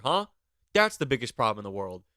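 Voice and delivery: ordinary talk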